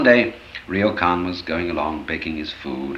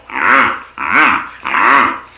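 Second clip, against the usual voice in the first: a loud playful tiger shout, repeated; 9 dB louder, much slower than usual. A narrator's reading. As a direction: bellow Ah three times at the tiger. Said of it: "Ryokan again shouted".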